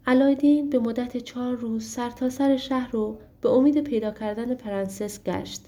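ordinary delivery, nothing marked

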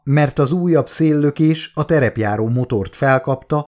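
ordinary delivery

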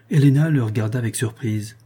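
usual speaking voice

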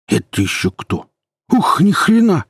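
startled poacher, voice leaping high. Acted. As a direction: blurt out shock at an unexpected sight.

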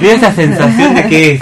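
talking through a laugh. Real, not acted. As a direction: let the laugh spill into the words. Laughing after a joke.

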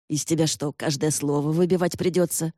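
usual speaking voice

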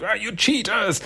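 high mock scream at the cheaters. Vlogger voicing Koya screaming at card cheaters. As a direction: shriek it in a high voice.

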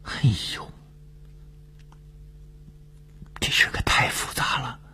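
high, hushed whispery murmur. A narrator's reading. Dongfeng taking in the news after a long silence, murmuring. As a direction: murmur it softly, as if dazed. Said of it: "过了好一会儿才喃喃地说".

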